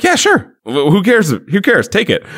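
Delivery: high, dismissive mock agreement; mock nonchalance. Character eagerly agreeing to hand it over, mock nonchalance.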